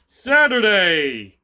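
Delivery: loud, drawn-out day announcement; announcer call. Pretend. Streamer announcing the weekend schedule to viewers, drawn-out loud call. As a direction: call out the day, stretching it long.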